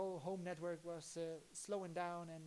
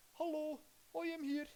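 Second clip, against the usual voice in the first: higher mock Dutch politician voice; lightly hammed-up Dutch premier greeting; Jan-Peter Balkenende impression; 9 semitones higher, 5 dB louder than usual. Streamer mimics Balkenende to show a Dutch accent, higher mock voice. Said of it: "hoe praten die ook alweer?".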